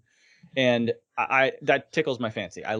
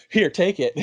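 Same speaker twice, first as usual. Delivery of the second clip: laughing; 8 semitones higher, 4 dB louder than usual. Retold or imitated. Acting out a giveaway, laughing.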